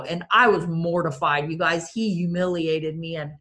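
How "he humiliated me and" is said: ordinary talk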